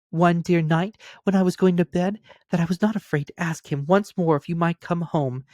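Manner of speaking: put-on high, delighted child voice; bubbling over with joy at the news; gleeful little girl. Sister tells brother of father, gleeful child voice. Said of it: "brimful of glee".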